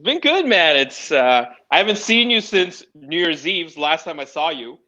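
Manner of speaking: normal talking voice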